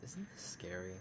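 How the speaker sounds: hushed spooked whisper. Real, not acted. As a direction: breathe it out, creeped out. Spooked by an eerie picture.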